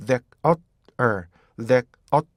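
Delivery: "that, uh, er" as three separate beats. Shown as a demonstration. Teacher splitting the word into three beats. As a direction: split "there" into "that, uh, er". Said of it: "이렇게 이거를 엇 하면서요".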